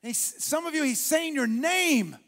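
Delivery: loud, high, shouted preaching building to song; preaching at full force. Acted. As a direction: preach it out with rising fire.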